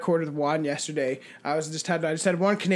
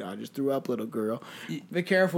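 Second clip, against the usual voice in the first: quiet inner voice; 5 semitones lower, 7 dB quieter, faster than usual. Retold or imitated. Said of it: "I'm, like, thinking".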